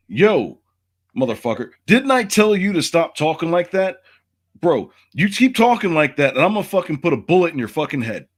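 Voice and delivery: high-pitched, indignant quote of someone threatening you; retold angry threat. Retold threat from hostile girl.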